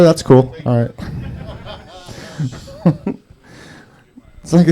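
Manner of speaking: ordinary talk, nothing marked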